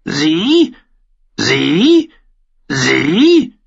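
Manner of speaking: high, loud raven squawk; rasping bird's repeated cry; raven echoing. A narrator's reading. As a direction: squawk it like a raven, rising sharply. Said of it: "der Rabe ... wiederholte".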